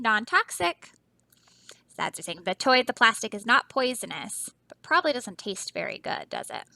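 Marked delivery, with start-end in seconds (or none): none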